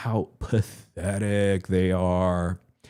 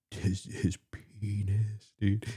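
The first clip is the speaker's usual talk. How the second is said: whisper; 7 dB quieter, slower than usual. Real, not acted. Whispering a crude joke.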